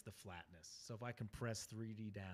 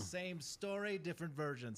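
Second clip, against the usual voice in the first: put-on higher Caribbean pirate accent; 6 semitones higher, 4 dB louder than usual. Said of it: "Can you say it in, like, the Caribbean accent?".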